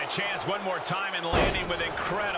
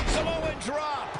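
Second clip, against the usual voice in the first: excited call; 10 semitones higher, much slower than usual. Acted. Commentator calls a move.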